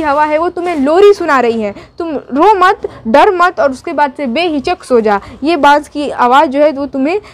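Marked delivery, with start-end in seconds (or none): none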